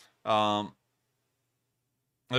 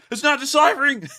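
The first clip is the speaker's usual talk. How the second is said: loud, high playful protest; playful outburst; 14 semitones higher, 10 dB louder than usual. Real, not acted. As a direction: burst out at yourself, laughing. Mock protest at own word.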